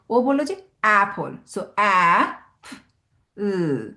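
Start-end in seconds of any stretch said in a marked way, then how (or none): 1.8-3.9 অ্যা, প-, ল split into separate sounds, প- whispered; sounding out apple. Shown as a demonstration. Teacher breaks apple into sounds for a child. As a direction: break the word into its sounds one by one. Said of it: "কিভাবে তাহলে সাউন্ডটা শেষ হলো".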